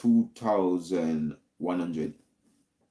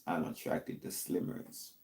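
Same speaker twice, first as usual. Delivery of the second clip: whispered confession; 5 semitones lower, 13 dB quieter than usual. Real, not acted. Confessing a taboo preference.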